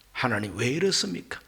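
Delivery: low, soft questioning of God; subdued protest. Acted. Job asks God why he suffers, in a low subdued protest. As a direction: protest quietly, voice dropped. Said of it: "이것이 바로 항변입니다".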